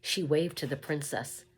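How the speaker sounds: ordinary delivery, nothing marked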